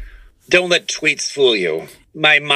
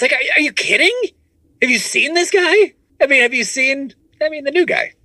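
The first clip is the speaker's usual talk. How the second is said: high mocking voice. Mocking excited fan reaction.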